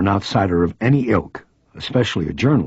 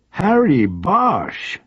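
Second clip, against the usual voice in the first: female reporter voice; 10 semitones higher, much slower than usual. Acted. Reporter greets old cop friend.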